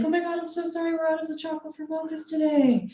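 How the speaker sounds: mock-sweet service voice; barista apology. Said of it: "I'd be like".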